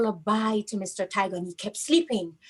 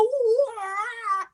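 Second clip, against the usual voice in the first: tiger wail; 12 semitones higher than usual. Acted. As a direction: let out a sharp high wail. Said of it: "he go".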